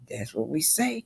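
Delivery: breathy whispered aside. Real, not acted. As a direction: lean in and whisper it.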